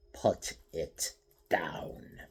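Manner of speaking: slow whispered command; menacing whisper. A narrator's reading. Stranger warning Raikou with the sword, slow low whisper. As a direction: barely breathe the threat. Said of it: "the stranger said".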